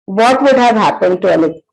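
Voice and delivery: normal talking voice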